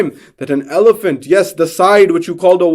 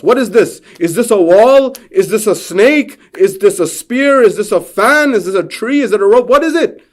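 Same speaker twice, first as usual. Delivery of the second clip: rising, louder, more urgent with each question; agitated questions; 6 semitones higher, 4 dB louder than usual. Acted. Confused blind men clamouring over the strange object, excited. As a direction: pile on the confused questions, climbing.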